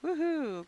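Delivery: loud high cheer; happy woohoo. Real, not acted. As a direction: whoop with joy. Cheering a win.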